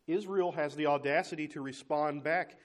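ordinary talk

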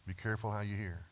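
soft repeat. Shown as a demonstration. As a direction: drop the voice and repeat it gently. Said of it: "And so be careful how you hear".

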